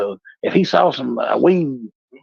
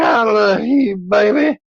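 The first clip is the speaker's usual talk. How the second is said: put-on higher, slurred drunk declaration; drunk lovey; 10 semitones higher, slower than usual. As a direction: gush it like a sloppy drunk. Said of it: "I'm like".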